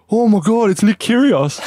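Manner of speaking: high, excited mock fan cry; starstruck fans. Couple spot tennis star leaving a car, mock starstruck squeal. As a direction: imitate fans gushing at a celebrity.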